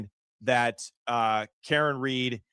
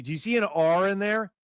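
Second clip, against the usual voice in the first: usual speaking voice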